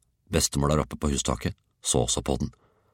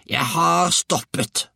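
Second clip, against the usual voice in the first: put-on high, slow old-woman screech; grandmother's reply; 4 dB louder, slower than usual. A narrator's reading. Grandmother answers boy from the roof, high slow voice. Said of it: "svarte hun".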